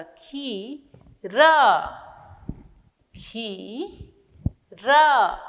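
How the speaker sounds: खीरा drawn out long on the ई; slow teacher model word; very slow syllable stretch. Shown as a demonstration. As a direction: stretch it slowly for children. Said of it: "ख में बड़ी की मात्रा".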